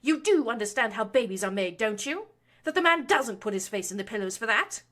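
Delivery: boiling over. A narrator's reading. Mother furiously berating her son about his marriage. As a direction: tear into him with contempt. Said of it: "the Baroness boiled over".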